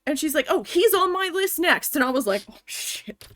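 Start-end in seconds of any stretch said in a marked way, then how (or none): 0.5-2.0 high, loud mock threat; imitated angry yelling. Office manager threatening the store manager next, imitated angry yelling. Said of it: "she starts screaming at me". 2.5-3.3 voiceless aside under the breath; whispered dismay. Retold or imitated. Worker hearing the manager's threat, whispered dismay.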